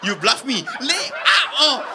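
mock accusing outburst, rising high; hammed-up indignation; overacted disbelief. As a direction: accuse like a soap opera relative. Family overacting at the doctor.

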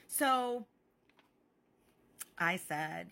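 ordinary talk

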